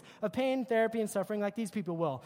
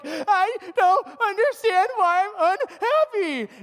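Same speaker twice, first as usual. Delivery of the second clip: higher, louder, slow whine in a put-on voice; mock whiny complaint; 9 semitones higher, 9 dB louder, slower than usual. Reality show cast moaning to the camera, whiny and slow. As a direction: whimper it like a miserable reality star. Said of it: "they're like".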